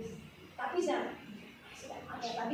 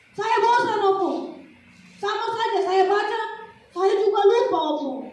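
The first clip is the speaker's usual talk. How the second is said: put-on high, louder bored child's whine; child complaining; 8 semitones higher, 8 dB louder than usual. Grandchild whining to grandpa about reading the Bible. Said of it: "Kayak gitu ya".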